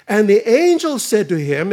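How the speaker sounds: usual speaking voice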